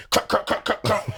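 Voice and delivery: imitated transformer crack, repeated. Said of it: "he's like a transformer".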